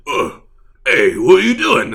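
loud, high goofy orc shout. Retold or imitated. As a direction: call over like a dim orc. Orcs find crew outside ship.

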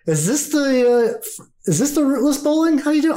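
high-pitched and wondering; puzzled, curious visitor. Acted. Plays visitor puzzled by bowling.

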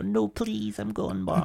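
high, put-on squeaky plea; squeaky mock plea; mock silly voice. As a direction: pitch it up like a silly put-on character. Mock voice answering a bar question.

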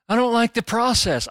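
high-pitched mock complaint; mock squeamish objector. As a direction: imitate a fussy objector, pitched well up. Mimics the squeamish objector, then agrees.

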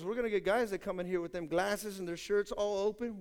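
ordinary delivery

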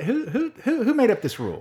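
high, incredulous who-who-who with a laugh; mock outrage. Real, not acted. Cohost playfully outraged at the hot dog rule, incredulous. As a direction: pretend indignation, pitch soaring.

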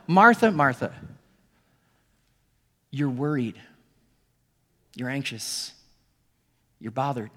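name her worries tenderly; gentle compassion. Acted. Jesus gently naming her worries.

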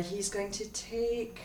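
unvoiced whispered damage. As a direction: breathe the damage quietly.

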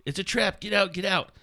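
high, urgent cry of alarm; acted urgent warning. Reading a squad's warning in the sewers, acted alarm. Reads a character's warning in battle.

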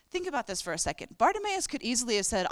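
ordinary delivery

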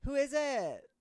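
high voice calling through a door; calling from another room. Acted. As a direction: call out from behind the door. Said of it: "from the other room you hear".

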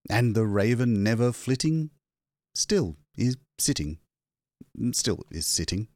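ordinary delivery

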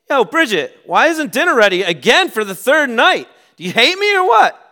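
loud, high-pitched irate husband yelling; angry confrontation. Acted. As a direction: shout it accusingly at your wife. Acted confrontation with his wife.

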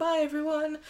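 high sing-song goodbye; mock carefree sign-off; breezy farewell. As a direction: wave them off cheerfully, pitched high. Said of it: "A bit like David Cameron".

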